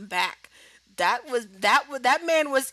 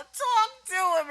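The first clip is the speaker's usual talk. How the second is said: high, slow begging call; a sidekick pleading with his cousin; pleading urge; 12 semitones higher, slower than usual. Retold or imitated. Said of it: "you hear Jay going".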